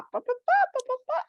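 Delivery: squeaky high "pá, pá, pá" imitating the nagging mind; high chatter noise. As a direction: chirp it high like endless chatter. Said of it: "e a mente tá lá". ("pá, pá, pá" bem agudo, imitando a mente tagarela)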